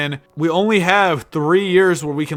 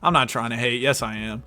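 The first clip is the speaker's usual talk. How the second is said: ordinary talk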